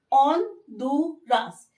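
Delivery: ऑनडुरास slowly, silent h, rolled r; slow on-DU-ras; Spanish pronunciation. Shown as a demonstration. Teacher models Spanish Honduras slowly. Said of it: "Spanish में बोला जाता है".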